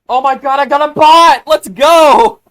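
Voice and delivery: loud, high-pitched celebration; real hyped-up shout; excited shout. Real, not acted. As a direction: yell it out, thrilled, stretching the last word. Excited reaction to getting a bot.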